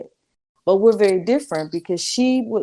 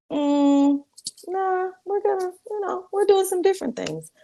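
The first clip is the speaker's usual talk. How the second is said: airy, much higher, carefree no; breezy brush-off; 13 semitones higher than usual. Retold or imitated. Telling her mother no, breezy and high. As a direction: toss it off lightly, like it's nothing. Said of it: "And I was like".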